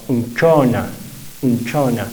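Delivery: Unchona stressed on the o, the u barely voiced. Shown as a demonstration. Teacher repeats Unchona, stressing the o. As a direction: lean on the o in Unchona. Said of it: "la acentuación va a estar en la O".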